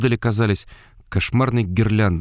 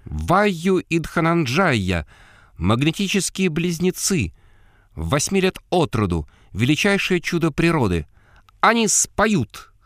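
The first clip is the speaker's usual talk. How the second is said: high, piercing barker's falsetto; slow, shrill fairground announcement; shrill female falsetto; 8 semitones higher than usual. Acted. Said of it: "пронзительный женский фальцет".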